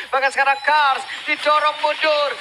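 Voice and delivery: loud rising play-by-play; esports caster. Acted. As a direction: call the kill with hype. Hyped call of a kill.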